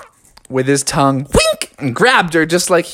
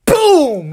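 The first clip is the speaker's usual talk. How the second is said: high, sudden boom; crash noise; 10 semitones higher, 3 dB louder than usual. A narrator's reading. Narrator sounding Froggy's mid-air collision, sudden high boom. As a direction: punch out the impact.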